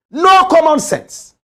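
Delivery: high, slow, contemptuous; scornful verdict. Real, not acted. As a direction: spit it out with disdain.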